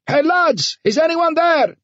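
very high, elated calling yell; triumphant yell. A narrator's reading. Ahmed hailing the lads in the station, triumphant high yell. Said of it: "he yelled, overwhelmed by senseless, triumphant laughter".

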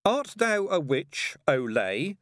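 slow, high, drawn-out mock interrogation; grand playground proclamation; child playing Chief Inquisitor. A narrator's reading. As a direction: intone it as a pretend inquisitor.

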